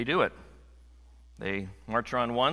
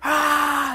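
loud, high wordless yell; crowd-style scream; 13 semitones higher, 8 dB louder than usual. Shown as a demonstration. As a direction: demonstrate a crowd's wordless shout. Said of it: "we just make a sound".

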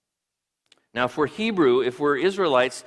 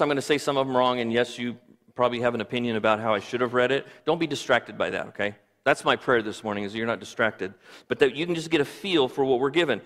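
usual speaking voice

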